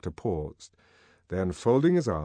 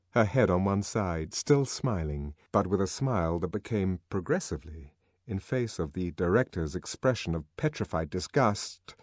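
usual speaking voice

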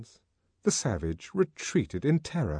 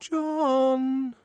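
high, drawn-out timid call; small ingratiating voice; 3 dB louder than usual. A narrator's reading. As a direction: call coaxingly from behind a closed door. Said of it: "a small, ingratiating voice from the bathroom".

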